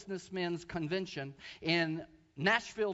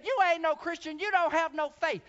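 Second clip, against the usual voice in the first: high, loud scornful yell; imitating a furious accusation; mock angry shout; 8 semitones higher, 6 dB louder than usual. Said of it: "she said".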